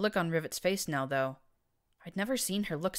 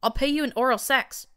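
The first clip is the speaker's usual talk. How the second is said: blurted; 10 semitones higher, 10 dB louder than usual. A narrator's reading. Blackjack to Textbook, blurting it out loudly. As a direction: blurt it out high and loud. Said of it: "I blurted".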